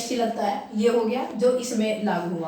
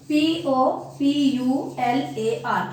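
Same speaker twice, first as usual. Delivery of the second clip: slow letter-by-letter spelling, P O P U L A R; measured classroom spelling with gaps between letters; much slower than usual. Shown as a demonstration. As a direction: spell it slowly, each letter on its own. Teacher spells out the word.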